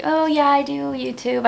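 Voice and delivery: slow, hesitant and apologetic; sheepish mumbled admission; insecure, embarrassed. Retold or imitated. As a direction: say it like you're ashamed of your job. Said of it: "insecure about it".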